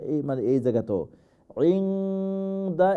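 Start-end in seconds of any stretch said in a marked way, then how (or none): 1.8-2.9 "ইনদা" with the n held long and nasal; ikhfa. Shown as a demonstration. Teacher naming the rule, long hidden n in "ইনদা". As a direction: hum the n before d in "ইনদা". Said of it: "ইখফা".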